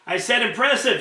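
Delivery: shouted. A narrator's reading. Commander shouting over a bad line. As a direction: shout it so he hears. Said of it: "shouted Root".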